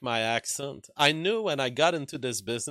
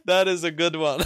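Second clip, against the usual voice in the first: laughing; 5 semitones higher, 7 dB louder than usual. Real, not acted. Laughing at viewer's comment.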